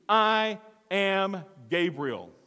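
"I am Gabriel" very slow, each word separate; great emphasis. Acted. Preacher showing the Greek emphasis, "I am Gabriel" slowly stressed. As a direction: lean hard on every word of "I am Gabriel". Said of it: "gives great emphasis to".

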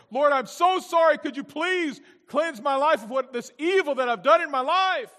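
building to a high, loud plea; impassioned repentance. Acted. Cain begs God to forgive him, impassioned repentance.

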